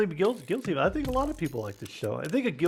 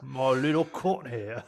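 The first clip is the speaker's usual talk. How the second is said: slow Cockney growl, menacing; menacing Cockney butcher drawl; Billy Butcher voice; slower than usual. Pretend. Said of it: "in my Billy Butcher voice".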